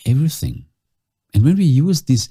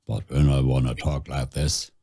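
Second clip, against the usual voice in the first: much lower and softer, sinking down; low quiet voice; 7 semitones lower, 6 dB quieter than usual. Shown as a demonstration. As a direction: drop the pitch right down and go soft.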